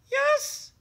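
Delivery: tiny high muffled mouse voice; muffled little mouse. Acted. Mouse answering Bear from hiding, muffled and high. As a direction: squeak it from under the bed. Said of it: "came a muffled voice".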